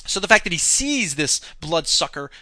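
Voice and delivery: ordinary talk, nothing marked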